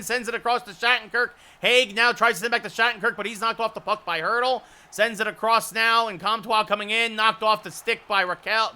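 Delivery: fast, rising live hockey call; rapid hockey play-by-play. Acted. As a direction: call the action as it happens.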